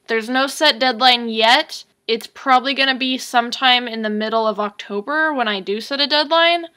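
normal talking voice

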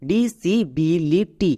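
Disability very slowly, in five separate beats; very slow teaching model of disability; slow syllable by syllable. Shown as a demonstration. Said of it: "তাহলে একসঙ্গে উচ্চারণ করো".

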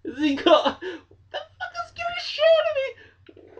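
very high, slow, shaken cry; slow high terrified wail; panicked. Real, not acted. Panicking after jump scare.

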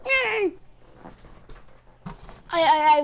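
high puppet-voiced calls; Elmo. Practising Elmo for friends, high puppet impression. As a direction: pitch it way up like a muppet. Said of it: "Try to do Elmo".

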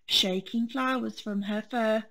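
ordinary delivery

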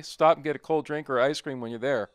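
normal talking voice

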